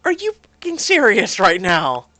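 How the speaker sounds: loud, indignant yell; a loud, furious protest at the scene; angry outburst. Real, not acted. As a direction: shout in disbelief. Seeing Troy's family there.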